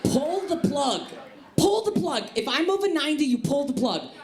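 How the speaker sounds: high, insistent East Coast aunt; Aunt Sherry. Acted. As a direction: order it as a high-pitched, anxious aunt. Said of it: "I do that impression".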